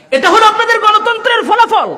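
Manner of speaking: high, ringing declamation; preacher's punchline. Real, not acted. Preacher flings verdict at audience. (চড়া গলায় ওয়াজের ঘোষণা)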